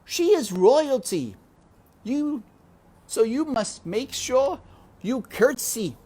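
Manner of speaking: high, loud bossy shout; head servant shouting. A narrator's reading. Louise shouting instructions at Mig, loud and high. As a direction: bark the rules at the new girl. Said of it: "shouted Louise".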